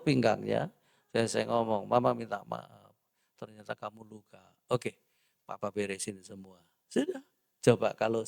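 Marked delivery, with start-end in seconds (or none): 1.9-6.6 soft, gentle apology fading quiet; a soft husband saying sorry; tender apology. Retold or imitated. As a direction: soothe the hurt wife. Husband apologising to hurt wife.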